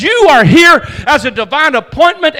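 shouted as God's announcement; shouting the word of God over the congregation; proclaimed shout. Acted. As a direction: ring it out like a divine decree.

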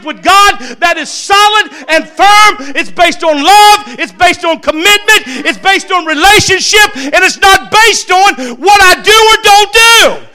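loud, high, slowing preacher's cry; rising revival preaching, slow and loud on the last lines; full-force preaching. Acted. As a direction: build it to a shouting climax. Pastor builds to full preaching.

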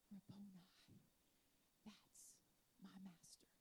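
Mary's awed recognition, almost silent; hushed awe. Acted. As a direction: breathe it out in wonder. Mary recognizing Jesus, hushed.

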